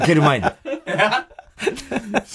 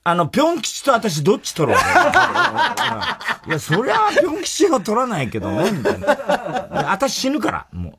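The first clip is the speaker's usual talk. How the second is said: mock boss voice; 13 semitones higher than usual. The lady boss's ultimatum, a high hammed-up voice. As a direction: overplay it like a wounded wife.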